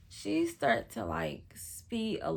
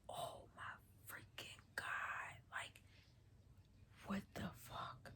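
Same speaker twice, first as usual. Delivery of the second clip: shocked whisper; 19 dB quieter than usual. Retold or imitated. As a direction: whisper it in disbelief, with pauses. Shocked at the crash, retold.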